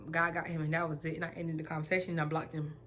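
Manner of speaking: ordinary talk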